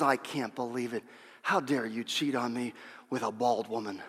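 low, soft punchline accusation; low jealous wife. Retold or imitated.

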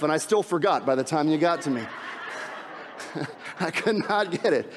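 laughing through the words; amused laughing. Real, not acted. Pastor admits he forgot the repeated words, laughing. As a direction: chuckle helplessly at your own slip.

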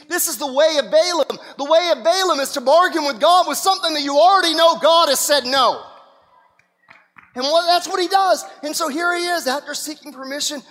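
loud, rising preacher's call; preaching. Acted. Preacher warning the congregation, loud and driving. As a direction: drive the warning home to the church.

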